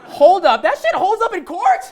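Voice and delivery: high, loud, laughing disbelief; incredulous. Real, not acted. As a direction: laugh out the outrage. Mock outrage at court loophole.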